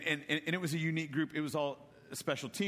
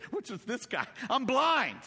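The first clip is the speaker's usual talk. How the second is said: comic exasperation; 9 semitones higher, 4 dB louder than usual. Retold or imitated. Blind man's comic retort to Jesus.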